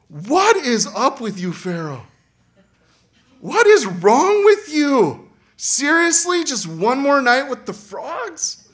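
high, loud and drawn-out outrage; slow incredulous scolding; exasperated disbelief. Acted. Preacher scolding Pharaoh, loud and exasperated.

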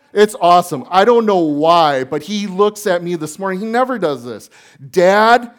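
loud, high, driving sermon voice; preaching at full force. Acted. Pastor preaches Romans chapter one to the congregation, loud and fervent. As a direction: preach it out to the room.

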